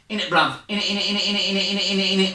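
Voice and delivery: lightly done Essex bloke, in it repeated; mock Essex chant; Essex accent. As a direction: rattle off in it like an Essex lad. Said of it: "I live here in Essex".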